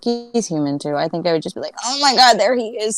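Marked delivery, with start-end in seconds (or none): 1.8-3.0 screaming internally. Retold or imitated. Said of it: "screaming internally".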